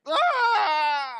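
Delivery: piercing high yelp of a faked foul; mock flop scream. Mocking LeBron's flop to the listeners, high fake yelp. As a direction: scream like you've been barely touched. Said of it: "he just like".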